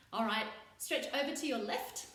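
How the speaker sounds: ordinary delivery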